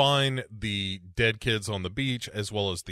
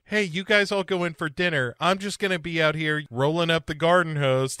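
higher-pitched child impression; bratty kid; 6 semitones higher than usual. Kid telling family to go in for dinner, higher mock-child voice. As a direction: play a scheming kid, voice pitched up. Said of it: "And the shitty kid is like".

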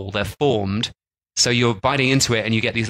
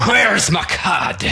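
put-on pirate accent; 10 semitones higher, much slower than usual. Host hams up a pirate who lost his cod, slow and high in a pirate accent. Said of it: "any excuse to use a pirate accent".

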